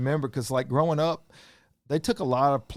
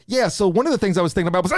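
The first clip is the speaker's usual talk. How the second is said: shouting; 7 semitones higher, 6 dB louder, faster than usual. Pretend. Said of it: "And it's like".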